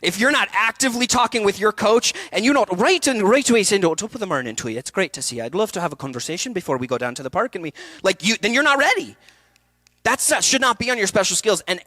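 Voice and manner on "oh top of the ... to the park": Irish dialect demo. Shown as a demonstration. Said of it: "showing ready Irish dialect".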